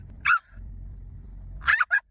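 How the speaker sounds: high, breathy shriek of a laugh. Real, not acted. Reading the ban notice, shrieking laugh. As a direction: burst out in disbelieving laughter.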